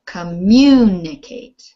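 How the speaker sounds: "Communicate" with a loud, lengthened MU; stress on second syllable. Shown as a demonstration. Teacher giving the answer to a student, "Communicate" heavily stressed on second syllable. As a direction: punch MU hard in a slow "Communicate". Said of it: "So it's the second syllable".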